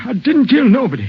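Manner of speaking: cornered suspect shouting his innocence; desperate denial. Acted. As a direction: blurt it out, voice jumping up. Suspect denies murder charge.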